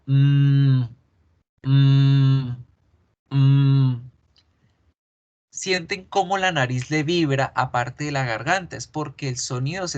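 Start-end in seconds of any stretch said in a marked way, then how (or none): 0.1-4.1 loud, steady hum through the nose; nasal humming demo. Shown as a demonstration. Teacher humming so students feel the nose vibrate. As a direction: hum the m long so the nose buzzes. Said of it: "hagan allá el siguiente sonido".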